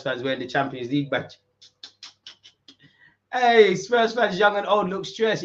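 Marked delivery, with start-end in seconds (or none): 3.3-5.4 slow, louder, higher mocking call; gloating taunt. Real, not acted. Streamer taunting stressed Spurs fans, slow gloating call. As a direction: drag it out to rub it in.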